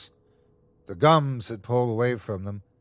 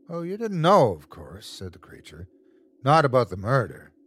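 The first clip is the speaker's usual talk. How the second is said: taunting creature. Acted. Creature reveals a secret to the wife, taunting. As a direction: toy with the victim.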